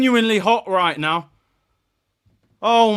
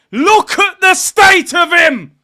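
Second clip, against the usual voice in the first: slow scornful shout; 8 semitones higher, 9 dB louder, slower than usual. Real, not acted. Repeats it louder and slower.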